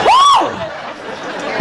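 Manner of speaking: sudden very high "woo!"; high-pitched comic yelp; high pained whoop. Acted. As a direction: yelp as if the toes hurt. Mock cry from cramped toes.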